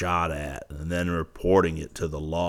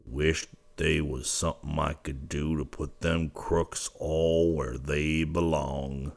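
wistful; 2 semitones lower, 6 dB quieter than usual. Acted. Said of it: "said Yuma wistfully".

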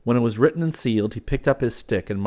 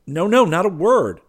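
high, loud, playful refusal; gaily; 10 semitones higher, 9 dB louder than usual. A narrator's reading. Hastings refusing to hear about the girl, gaily. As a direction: wave it off gaily, voice pitched up. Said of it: "he said gaily".

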